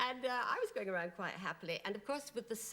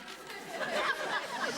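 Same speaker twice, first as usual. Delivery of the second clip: high peal of real laughter; helpless high laugh; burst of laughter; 5 dB louder than usual. Real, not acted. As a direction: laugh out loud at the memory. Laughing at her own story.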